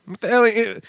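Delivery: a startled, joking disbelief; high-pitched mock shock; mock outrage. Real, not acted.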